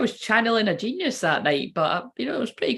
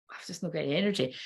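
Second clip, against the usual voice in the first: much softer, quick and drained; drained self-quote; 3 semitones lower, 9 dB quieter, faster than usual. Retold or imitated. Telling listeners how you stood in the room, drained and quiet. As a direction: quote yourself with the energy gone, under your breath. Acts out having no energy.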